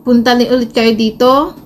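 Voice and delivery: ordinary talk, nothing marked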